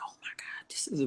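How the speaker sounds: whispered, dawning disbelief; hushed shock, hardly any voice; stunned whisper. Retold or imitated. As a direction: whisper it to yourself in awe. Stunned inner reaction, retold.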